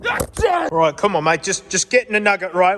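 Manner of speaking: a pushy bloke rushing his mate to the car; ocker Aussie bloke. Acted. Ocker mate urging the Nugget off.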